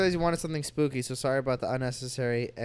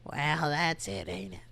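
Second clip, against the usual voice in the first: grandma voice; 5 dB quieter, slower than usual. Acted. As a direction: play a sassy old grandma. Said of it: "in the grandma voice".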